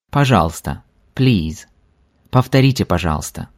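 usual speaking voice

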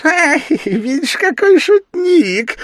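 very high, loud gleeful squeal; tickled little demon voice; devil crying out, laughing. A narrator's reading. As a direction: shriek with amusement, stretching it out. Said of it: "закричал, смеясь, чёрт".

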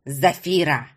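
put-on old man's low, lightly growled call. A narrator's reading. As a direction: growl the name in displeasure. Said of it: "прорычал старик".

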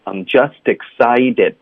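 unhurried teacher demo of "I'm just excited" with the d sound; linked, t voiced. Shown as a demonstration. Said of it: "我们浊化成的".

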